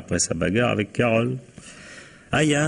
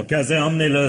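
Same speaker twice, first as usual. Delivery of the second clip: calling out an order; 9 semitones higher than usual. Acted. Inspector orders officer Cazet.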